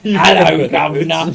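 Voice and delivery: bad fake Cockney accent; Russell Crowe's Cockney accent. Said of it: "worst Cockney accent".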